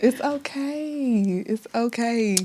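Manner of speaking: slow, soft reassurance; tender and unhurried, softly spoken; gentle comforting. Real, not acted. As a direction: soothe a friend who is fighting tears. Comforting friend who is near tears.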